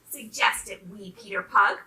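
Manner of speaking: ordinary delivery, nothing marked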